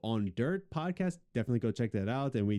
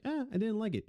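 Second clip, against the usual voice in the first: dismissive; 11 semitones higher, faster than usual. Retold or imitated. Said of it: "you're like".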